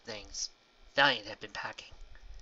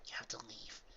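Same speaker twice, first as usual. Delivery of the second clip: whispered; 16 dB quieter than usual. Acted. Gentle final dismissal.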